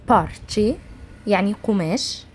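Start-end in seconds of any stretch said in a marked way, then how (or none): 0.1-0.7 بارتشي opened with a puffed p, as "parche"; aspirated P. Shown as a demonstration. Teacher showing the Persian pe, بارتشي said with puffed p. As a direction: start بارتشي on p, never b. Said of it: "با اللي فيها همس".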